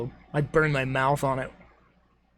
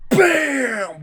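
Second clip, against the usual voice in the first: loud; 10 semitones higher, 4 dB louder than usual. Retold or imitated. Retelling bumping into a lady.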